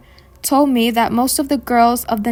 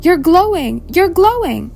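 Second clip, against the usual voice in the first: higher, louder, mock-upset exclamation; upset retold cry; 6 semitones higher, 4 dB louder than usual. Stripper upset at the glowing visitor, crying out in imitation. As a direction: hammer it twice in rising distress, without real tears. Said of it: "started to cry and get upset".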